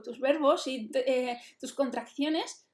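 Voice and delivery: usual speaking voice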